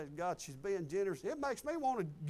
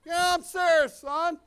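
loud, higher emphatic reply; hearty shout; 7 semitones higher, 11 dB louder, slower than usual. Retold or imitated. Cashier telling the preacher the hot dog is free, loud. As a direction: boom it out with joy.

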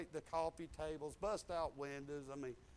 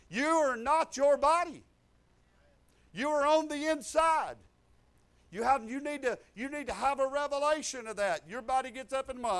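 full-force preaching; 8 semitones higher, 9 dB louder than usual. Real, not acted. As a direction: lift the scripture over the crowd.

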